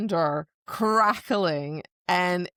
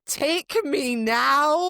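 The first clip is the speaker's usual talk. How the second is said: swooning; 5 semitones higher, 4 dB louder, much slower than usual. Retold or imitated. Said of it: "I'm like".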